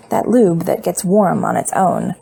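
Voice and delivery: usual speaking voice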